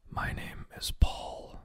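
weak whispered introduction. A narrator's reading. Man introducing himself to the babysitter, whispering. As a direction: whisper it slowly, barely any voice. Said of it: "whispered the man".

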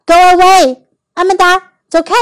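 high, loud dismissal; bossy child. A narrator's reading. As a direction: shoo her off like a cross little boy. Oliver sends Amanda away.

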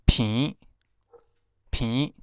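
平 as "phênn", nasal vowel, no final ng; Hokkien reading. Shown as a demonstration. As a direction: say 平 as Hokkien "phinn". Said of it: "彭念成平".

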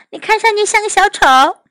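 put-on high, quick child character voice; Amanda. A narrator's reading. Girl teases Oliver in Mandarin, high quick voice. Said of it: "阿曼达看了看奥利弗".

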